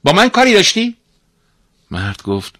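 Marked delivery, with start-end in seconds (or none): 0.0-1.0 high, loud character voice; summoned master. Acted. Master emerging from the spring, loud and imperious. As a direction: ask high and loud, like a conjured-up figure.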